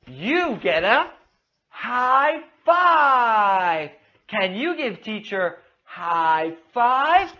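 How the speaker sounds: slow, exaggerated delivery with drawn-out key words; animated children's teacher. Shown as a demonstration. Teacher praising and prompting little Amanda, animated and slow. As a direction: cheer the child on with big, swooping intonation.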